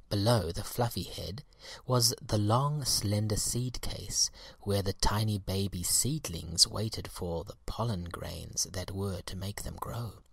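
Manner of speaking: usual speaking voice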